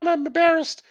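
high-pitched mock embarrassed wail; embarrassed woman. Imitating a woman in bed, mock embarrassed squeal. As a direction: play her mortified in a put-on high voice. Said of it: "You're like".

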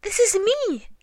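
high, loud astonished protest; very much surprised. A narrator's reading. Pooh hears he is elsewhere, loud high surprise. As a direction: exclaim it in amazement. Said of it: "very much surprised".

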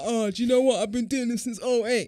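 mimicking Tony. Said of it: "And Tony's like".

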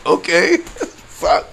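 stunned, half-laughing; okay laughed, fuck breathed; laughing disbelief. Retold or imitated. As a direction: laugh out an okay then whisper the curse. His own stunned reaction retold.

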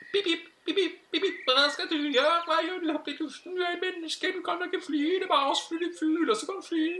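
very high, loud chirping little bird; tiny indignant bird voice; sparrow. Acted. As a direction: squeak it out like a scolding sparrow. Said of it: "så møtte jeg på en spurv".

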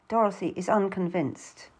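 plain reading voice

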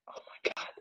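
breathless whispered gasp of relief; awed whisper. Retold or imitated. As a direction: whisper it in disbelief. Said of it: "we're like".

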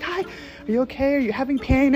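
high, soft nurse voice; concerned nurse. Retold or imitated. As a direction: lightly voice a gentle, solicitous nurse. Nurse checking on screaming patient.

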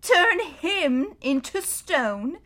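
slow, amused disbelief; drawn-out mocking echo; scoffing. Mister Beaver scoffs at Edmund's fear, slow and amused.

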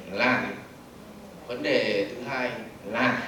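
slow, low and soft droning introduction; slow dull speech. Shown as a demonstration. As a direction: drone on slowly like a boring speaker. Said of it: "tôi lấy ví dụ này".

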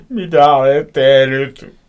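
loud, high, drawn-out yell; slow full-volume outraged cry; angry hysterical shriek. A narrator's reading. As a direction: scream it as a furious accusation. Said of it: "Kiljuin vihaisena ja hysteerisenä".

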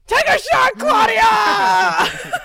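cheering shout. Real, not acted. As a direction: hype her up, shout it. Cheering friend to drink.

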